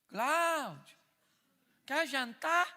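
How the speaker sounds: wife calling out. Retold or imitated. Wife calling from the living room, sweetly. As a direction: call him sweetly to dinner.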